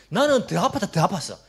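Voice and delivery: impatient, belittling nag; scornful scolding. Retold or imitated. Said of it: "이렇게 말하시는 분이 있습니다".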